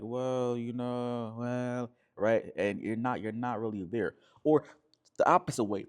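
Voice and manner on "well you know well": lightly mocked drooping hedging; slouched, noncommittal. Slouched man not taking it seriously, mock noncommittal. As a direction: imitate someone not really there. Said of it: "So you're slouched, you're saying things like".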